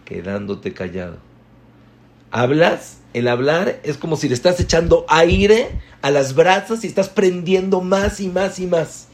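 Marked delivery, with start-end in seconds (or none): 2.3-9.1 higher, louder, building sermon; fervent preaching. Acted. The rabbi preaching to his students about feeding a quarrel, fervently. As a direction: drive the fire image home with force.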